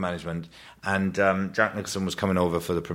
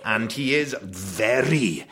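dad's posh voice; 3 semitones higher, much slower than usual. Retold or imitated. Son describing his dad in the dad's posh voice. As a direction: mimic a Welsh dad going posh. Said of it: "he puts on a rather posh voice".

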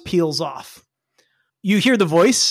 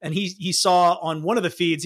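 ordinary talk

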